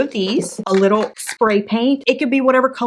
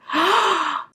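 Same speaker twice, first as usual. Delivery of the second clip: delighted gasp; 10 semitones higher than usual. Real, not acted. Shows off lit shelf.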